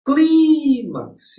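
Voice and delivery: "clímax" with CLÍ held long and high; loud, high "CLÍÍÍ-max"; stressed-syllable demo. Shown as a demonstration. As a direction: stretch the first syllable of "clímax". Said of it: "a sílaba tônica aqui".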